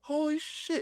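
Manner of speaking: very high, soft stunned exclamation; awed shock. Retold or imitated. Staring at his double in the vision, high awed disbelief. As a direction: breathe it out high in amazement. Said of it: "I'm like".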